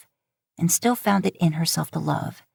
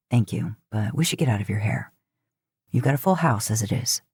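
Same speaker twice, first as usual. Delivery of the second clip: lower, steady, reassuring man; low brotherly reassurance; Dean's voice; 7 semitones lower than usual. Acted. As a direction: drop low and calm for Dean. Narrator voices Dean.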